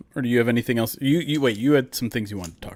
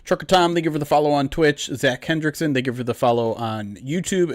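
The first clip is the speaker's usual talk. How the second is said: normal talking voice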